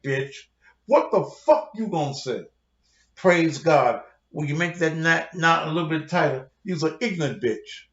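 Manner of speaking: normal talking voice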